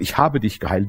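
low, soft reminding voice; barely above a murmur; the Spirit gently reminds him; hushed reminder. Retold or imitated.

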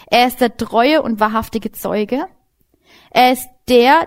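usual speaking voice

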